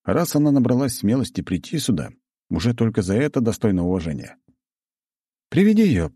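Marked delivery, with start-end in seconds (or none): 5.5-6.1 high, loud order; commanding. A narrator's reading. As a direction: call the order out firmly. Lord gives order.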